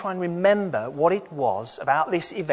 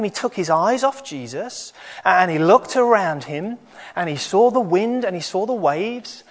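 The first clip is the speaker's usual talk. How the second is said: put-on lively higher children's storyteller; kids show host; 5 semitones higher than usual. Children's talk, higher animated voice.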